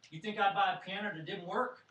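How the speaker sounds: indignant retort. Retold or imitated. Father to his doubting son about the gift piano, indignant. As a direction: throw it back as an indignant question.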